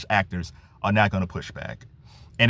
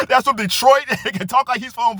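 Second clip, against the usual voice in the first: high, loud laugh into the words; real amused laugh; 12 semitones higher, 4 dB louder than usual. Real, not acted. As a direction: talk while cracking up. Laughs at the absurdity.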